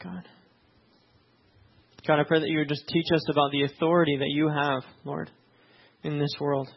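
normal talking voice

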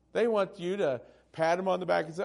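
normal talking voice

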